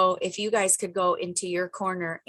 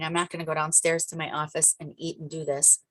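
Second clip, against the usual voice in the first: usual speaking voice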